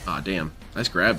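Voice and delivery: ordinary talk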